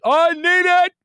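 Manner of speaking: put-on very high, loud desperate child voice; whiny toddler wail. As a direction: plead like a toddler mid tantrum. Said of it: "they're crying".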